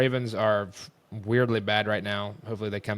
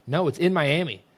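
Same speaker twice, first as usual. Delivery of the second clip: higher-pitched mimicked stubbornness; insistent contradiction; 6 semitones higher, 4 dB louder than usual. Girl refusing to believe him, mock insistent. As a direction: mimic her digging in.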